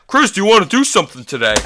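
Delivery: high, quick mimicry of the friend; mocking the friend. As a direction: parrot his question, sped up.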